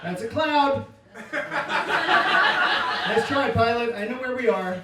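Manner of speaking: high, loud jeer at the pilot; loud, scornful mock protest; mock heckling. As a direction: call it out like a know-it-all passenger.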